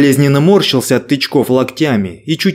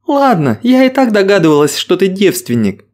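put-on higher, playful girlish tease; teasing young woman; 5 semitones higher than usual. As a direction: tease him lightly, with a giggle. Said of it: "хихикнула она".

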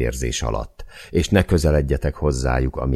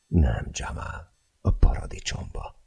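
whisper; 4 dB quieter than usual. Acted. Csilla pulled onto Jamal.

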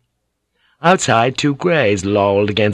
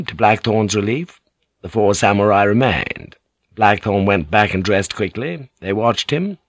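ordinary delivery, nothing marked

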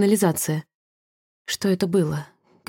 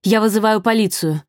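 mildly raised, urgent call; shouting; 3 semitones higher than usual. A narrator's reading. Vadim warns Sasha through the glass, mild shout. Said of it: "крикнул Вадим".

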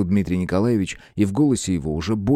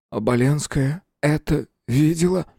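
dismayed, higher-pitched question; sinking voice; 6 semitones higher than usual. A narrator's reading. Horrified suspect learning a girl saw it, dismayed. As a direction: ask it as your heart sinks. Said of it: "спросил он упавшим голосом".

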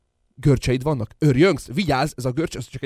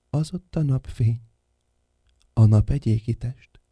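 ordinary delivery

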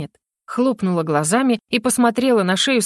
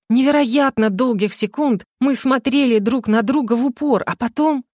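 ordinary delivery, nothing marked

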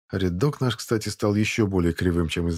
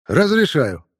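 approving grunt; 6 semitones higher, 6 dB louder, slower than usual. A narrator's reading. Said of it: "одобрительно крякнул Шипко".

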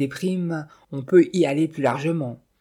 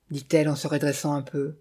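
ordinary delivery, nothing marked

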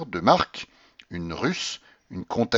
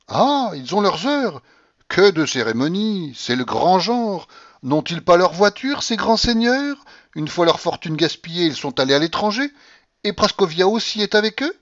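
scornful grandmother; 10 semitones higher, 6 dB louder than usual. A narrator's reading. Grandmother mocking the general's family, high and scornful. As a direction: sneer at the family's grand airs.

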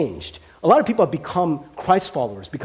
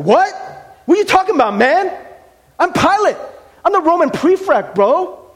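much higher and louder, sputtering outrage; outraged Pilate; 11 semitones higher, 6 dB louder than usual. Retold or imitated. Pilate outraged that Jesus ignores his rank, shouting high. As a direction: shout it like an insulted official. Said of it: "Pilate's like".

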